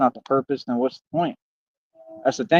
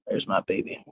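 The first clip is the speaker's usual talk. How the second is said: whispered greeting; 10 dB quieter than usual. Real, not acted.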